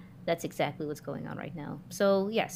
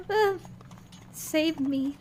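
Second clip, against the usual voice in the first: higher little plea for rescue; pleading aside; 9 semitones higher, much slower than usual. Acted. Vilma silently begs the nun to rescue her, mock pleading. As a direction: beg the nun for help, half joking. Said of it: "It's like".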